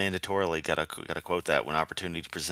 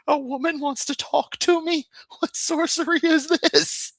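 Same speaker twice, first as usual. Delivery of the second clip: high, hammed-up disbelief, much higher; mock astonishment; 4 dB louder than usual. Joking that a woman wanting to talk is magic, squealed in mock shock. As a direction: squeal it like an awestruck wizard.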